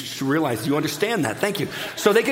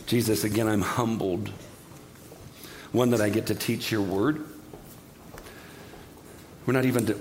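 low, subdued praying voice; humble prayer; 5 semitones lower, 4 dB quieter than usual. Real, not acted. Preacher humbly thanking Jesus before the church, low prayer. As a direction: pray softly with unhurried pauses.

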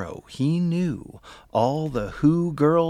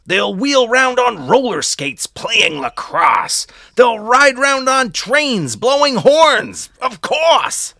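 louder and higher, near shouting; excited loud build; 7 semitones higher, 7 dB louder than usual. A narrator's reading. Loud excited din described.

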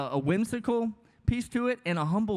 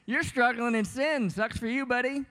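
smug mockery; 7 semitones higher than usual. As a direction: sneer it like a know-it-all looking down. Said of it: "You're just like".

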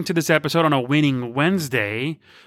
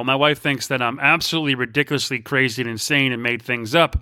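usual speaking voice

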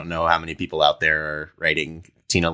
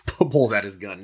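normal talking voice

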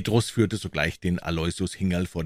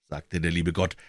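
plain reading voice

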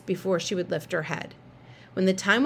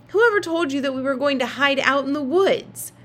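demanding; 6 semitones higher, 4 dB louder than usual. A narrator's reading. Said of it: "Claudia demanded".

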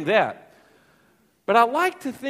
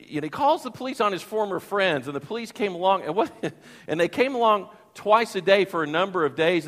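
normal talking voice

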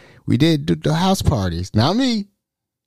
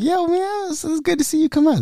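high greeting voice; 3 dB quieter than usual. Retold or imitated. Voicing friend greeting sous chef.

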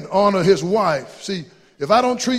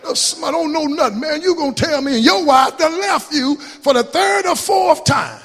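preaching at full force; 7 semitones higher, 4 dB louder than usual. Acted. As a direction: thunder it from the pulpit.

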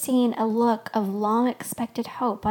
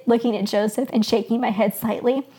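ordinary delivery, nothing marked

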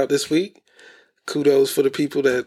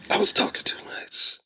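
whispered aside; 5 dB quieter than usual. Real, not acted. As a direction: breathe it out, unvoiced. Explaining hiccups, aside.

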